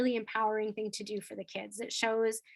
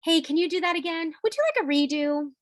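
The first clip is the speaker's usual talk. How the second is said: bright, higher teasing question; playful tone; 6 semitones higher, 5 dB louder than usual. Shown as a demonstration. Teacher catching a kid talking in the hallway, playful. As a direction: nudge them to redo it, lightly. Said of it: "say with a playful tone".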